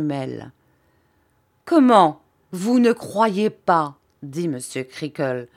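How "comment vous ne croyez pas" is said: indignant. Acted. Creakle indignant at the teacher.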